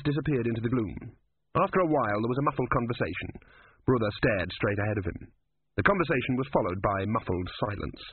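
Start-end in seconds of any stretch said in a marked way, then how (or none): none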